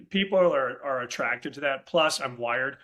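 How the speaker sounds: usual speaking voice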